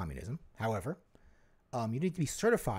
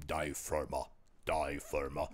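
German accent; 11 semitones lower, 6 dB quieter, slower than usual. Shown as a demonstration. A low German accent naming the East German band Die Firma.

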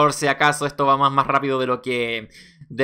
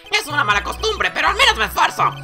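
high-pitched hammy clown voice; self-pitying clown; 13 semitones higher than usual. Acted. Clown confesses to the court, high-pitched pleading. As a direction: plead your case in a high voice.

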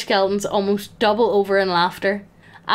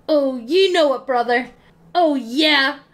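higher, hammed-up wrestling-promo bellow; bellowing wrestler; 5 semitones higher than usual. A drunk skeleton posing, bellowing like a wrestler. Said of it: "bellows out".

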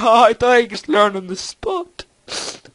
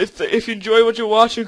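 usual speaking voice

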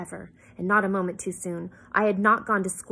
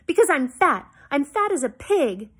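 louder, higher, frustrated outburst; frustrated shout; 5 semitones higher, 7 dB louder than usual. A narrator's reading. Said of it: "I shouted in frustration".

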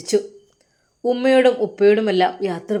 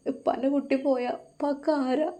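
soft, higher, broken-hearted cry; a father losing his daughter, weeping softly; father sobbing; 4 semitones higher, 6 dB quieter than usual. Acted. Father pleading to his leaving daughter, softly sobbing. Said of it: "ആ പിതാവ് ഹൃദയം പൊട്ടി കരയുകയായിരുന്നു".

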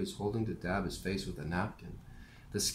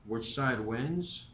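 slow musing; 3 semitones higher, much slower than usual. Acted. Morrie musing slowly.

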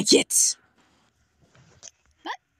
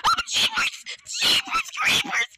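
scared squeal; 5 dB quieter than usual. Real, not acted. Startled by game enemy.

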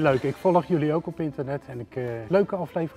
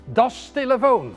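"Das Telefoon" hoger, luider en langzaam als Duits commando; Duits bevel; 8 semitones higher, 7 dB louder, slower than usual. Retold or imitated. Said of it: "luistert alleen maar naar Duitse bevelen". ("Das Telefoon" met nep-Duits accent, gebiedend)

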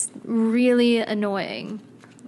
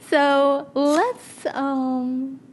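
higher-pitched, trying not to laugh; holding back giggles; 4 semitones higher, much slower than usual. Real, not acted. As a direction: fight down a real laughing fit. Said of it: "the laughs real bad".

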